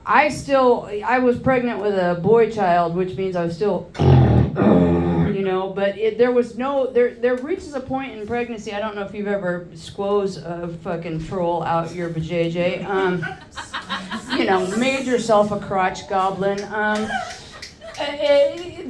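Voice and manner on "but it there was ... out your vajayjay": ordinary talk, nothing marked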